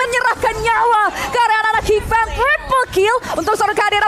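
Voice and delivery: high, loud hype play-by-play call; fighting game announcer. Real, not acted. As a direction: shout the winning moment like a caster. Caster calls the winning fight. (komentator heboh berteriak)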